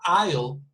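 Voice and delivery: "I'll" opening on an "eye" vowel; long I vowel. Shown as a demonstration. Teacher contrasting I'll with ill for students, "I'll" with an eye vowel. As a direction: start "I'll" with the diphthong of eye. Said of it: "the vowel sound at the beginning".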